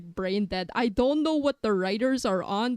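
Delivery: normal talking voice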